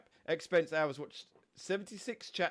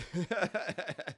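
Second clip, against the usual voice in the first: amused laugh; 3 semitones higher than usual. Real, not acted. Streamer admits teaching Scout French swear words, amused laughing. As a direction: burst of laughter.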